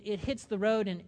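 ordinary talk

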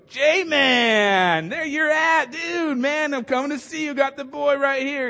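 high, loud surfer dude calling down; excited surfer dude. Retold or imitated. Friend poking his head through the roof to Jesus, loud surfer voice. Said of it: "I view 'em as surfers".

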